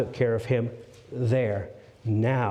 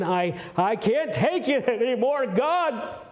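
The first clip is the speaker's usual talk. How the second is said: anguished cry; 9 semitones higher than usual. Acted. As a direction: voice cracking up high in despair. Elijah cries out in despair.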